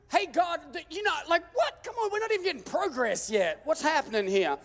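high, exasperated protest to God; frustrated outcry. Retold or imitated. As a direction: cry out at God in disbelief. Frustrated at God, car towed.

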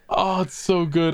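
ordinary talk

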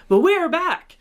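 ordinary talk

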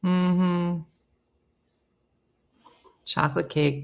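usual speaking voice

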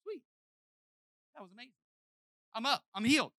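high, hushed awe; amazed healed man. Retold or imitated. Preacher voicing the healed man, high and hushed awe. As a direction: play the stunned healed man. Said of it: "the guy was like".

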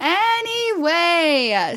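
exaggerated slow change of subject; drawn-out segue. Real, not acted. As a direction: stretch ANYWAY right out, lifting the pitch. Echoes co-host to move on.

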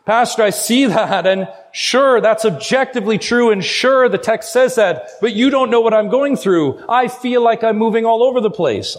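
agitated doubter's complaint, voiced by the preacher; protesting congregant. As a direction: push back at the pastor, conceding then protesting. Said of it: "You would say".

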